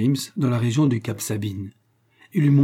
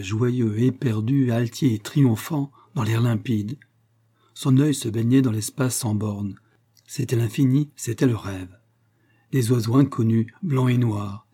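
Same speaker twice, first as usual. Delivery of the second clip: ordinary delivery, nothing marked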